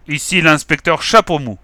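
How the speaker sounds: booming. Acted. Inspector announcing himself through the door, booming. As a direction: thunder the announcement. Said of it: "a tonné la voix".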